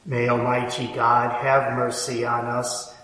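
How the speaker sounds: usual speaking voice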